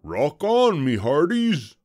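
loud, high rallying call; pirate bellow. A narrator's reading. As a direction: bellow to your pirate crew. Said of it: "bellowed Braidbeard".

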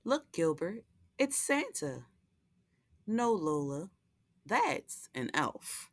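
lively picture-book read-aloud with character voices; reading to children. A narrator's reading. Host reading Lola the Elf to young viewers, storytelling voice. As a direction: read it like a bedtime story for little ones.

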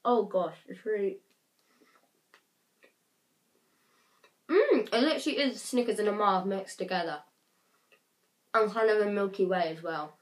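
talking while chewing; chewy sticky mouthful; mouth full. Real, not acted. Seen 1: Bites bar at -1, hand at mouth chewing, grimacing throughout.

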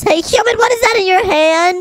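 high squeaky taunting voice; high character voice. Acted. Sister character taunting human.

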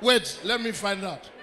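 ordinary talk, nothing marked

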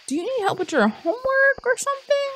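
very high, squeaky-pitched disbelief; incredulous questioning. Real, not acted. Player asks Abigail about homework, high incredulous pestering. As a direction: push the questions way up high, exasperated.

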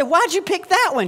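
high, indignant why; mock-indignant disbelief; surprised question. Dad asking daughter.

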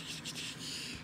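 whispered coaxing call; whispering. Real, not acted. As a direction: breathe the words to the bird. Calling softly to the bird.

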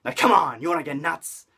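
high, loud Keaton-style outburst; hammed-up crazed shout; mock manic yell. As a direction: bellow it like a madman smashing a vase. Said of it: "and goes".

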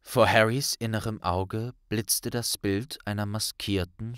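plain reading voice

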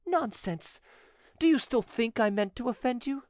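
high-pitched, insistent woman; indignant, admiring widow. Acted. Widow assures notary she meant no offence, high insistence. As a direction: brush off his objection in a high voice.

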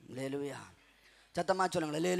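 ordinary talk, nothing marked

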